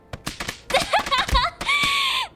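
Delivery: shrieking high laugh. Real, not acted. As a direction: burst into a high squealing laugh. Streamer laughs at redeem.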